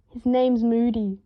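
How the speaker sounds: plain reading voice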